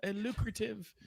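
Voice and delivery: high, soft imitation of a co-host; hushed, high-pitched impression; Martin. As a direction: mimic a colleague's pet word, soft and high. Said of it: "In Martin's voice".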